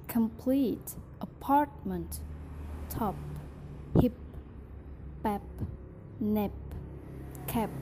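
crisp word-by-word drill reading; pronunciation drill. Shown as a demonstration. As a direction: read each word cleanly for practice.

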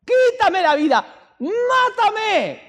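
very high, desperate drawn-out cries; acted-out prophet's anguished yelling; shouting at God. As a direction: scream to heaven, begging to die. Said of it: "empieza a gritarle a Dios".

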